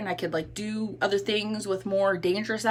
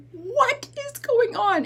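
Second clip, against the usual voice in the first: high, drawn-out mock outcry; slow, high-pitched retold exasperation; exasperated; 14 semitones higher, slower than usual. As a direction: act out a mom at her wits' end. Exasperated mother quotes herself.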